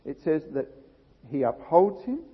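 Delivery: ordinary talk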